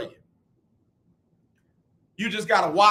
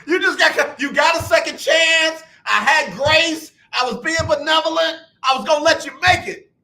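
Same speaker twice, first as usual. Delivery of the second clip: higher, louder mock rant; mock scolding; 7 semitones higher, 6 dB louder than usual. Real, not acted. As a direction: scold him with a grin, loud. Host scolds caller mock-angrily.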